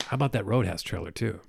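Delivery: usual speaking voice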